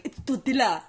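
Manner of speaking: mocking correction in a thick Spanish accent; exaggerated Spanish accent. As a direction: overdo the Spanish accent on "tortilla". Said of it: "I was trying to exaggerate".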